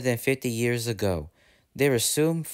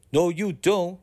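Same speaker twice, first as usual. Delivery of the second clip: higher, firm refusal; 6 semitones higher than usual. Acted. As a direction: push back firmly against the wind. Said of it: "he said firmly".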